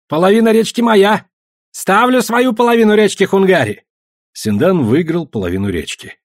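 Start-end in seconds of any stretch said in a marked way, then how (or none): 0.1-3.8 high, insistent calls; heated shouting. A narrator's reading. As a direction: shout out a claim and bet. Gamblers shout over the stake.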